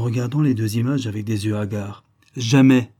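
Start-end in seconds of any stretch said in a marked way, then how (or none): 2.4-2.9 much louder, final jamais; firm repeat. A narrator's reading. Aliette repeating her refusal to Floch, loud. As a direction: hammer the single word. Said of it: "répéta Aliette".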